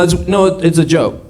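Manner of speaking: ordinary delivery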